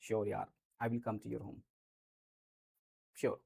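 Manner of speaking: low and soft, slowly and warmly; reassuring sure yaar, said soft and slow; soft, slow reassuring promise. Shown as a demonstration. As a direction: promise it gently to a friend. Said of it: "ऐसे बोल दिया हमने".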